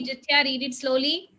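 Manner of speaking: ordinary delivery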